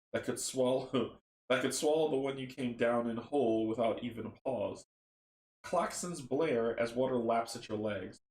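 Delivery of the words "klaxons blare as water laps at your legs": ordinary delivery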